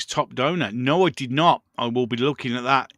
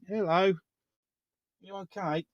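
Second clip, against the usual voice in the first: talking to the cat; 6 semitones higher, 6 dB quieter than usual. Real, not acted. Greeting the cat.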